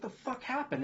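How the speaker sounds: shocked disbelief. Retold or imitated. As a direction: blurt it out in sudden disbelief. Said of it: "I was like".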